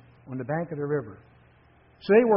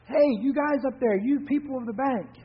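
high raised call to the cliff top; yelling up; 10 semitones higher than usual. A narrator's reading. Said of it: "and he yelled up".

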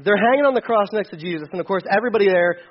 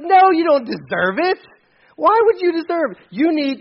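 much higher, louder jeering protest; crowd's outrage; 9 semitones higher, 4 dB louder than usual. Retold or imitated. Crowd below the cross protesting, outraged. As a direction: shout it like an outraged mob. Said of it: "everybody around would be like".

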